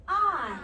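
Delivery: I said high and loud; sounding out I. Shown as a demonstration. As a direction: stretch the I sound. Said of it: "Watch me".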